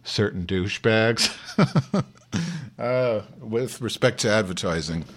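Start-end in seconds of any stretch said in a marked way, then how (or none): 1.4-2.0 short high chuckle; brief burst of high laughter. Real, not acted. Laughs at own nasty remark.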